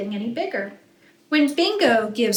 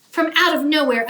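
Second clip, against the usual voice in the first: usual speaking voice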